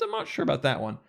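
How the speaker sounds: normal talking voice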